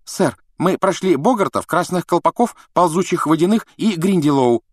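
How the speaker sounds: put-on high, quick know-it-all girl; eager schoolgirl. A narrator's reading. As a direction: rattle it off eagerly. Hermione interrupts eagerly.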